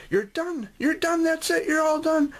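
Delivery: high, sing-song nurse cheering; cheerful nurse. Retold or imitated. Nurse telling the crying boy it's over, high and bright. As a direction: lift your voice way up like a nurse praising a little kid. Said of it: "the nurse would say".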